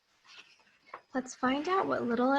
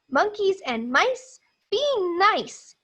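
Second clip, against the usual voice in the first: a hint of a gentle scold, higher; lightly voiced Little M telling them off; Little M tells monkeys and mice off kindly; mild scolding; 8 semitones higher than usual. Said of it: "he said".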